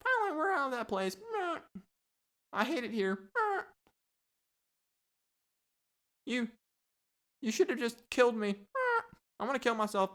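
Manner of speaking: high squawky parrot voice; jailed parrot. Acted. Parrots talking in parrot jail, high squawky voice. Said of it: "they're just all in there talking to each other".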